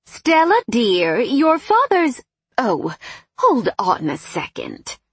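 high, loud, drawn-out motherly voice; mother on phone. A narrator's reading. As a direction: swoop up on the daughter's name.

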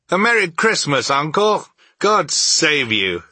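high, loud, bright greeting; cheerful cry. A narrator's reading. Nephew greeting his uncle at Christmas, cheerful and loud. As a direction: call it out cheerfully, high and ringing. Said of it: "cried a cheerful voice".

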